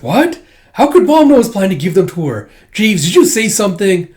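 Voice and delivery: high, loud, panicked questions; flustered shock. Retold or imitated. Boy panicking at his butler, high and loud. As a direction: blurt it in flustered alarm. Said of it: "He's like".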